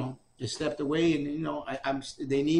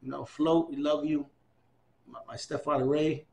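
ordinary talk, nothing marked

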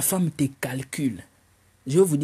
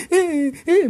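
mock wailing; 14 semitones higher, 5 dB louder than usual. Boy crying over car.

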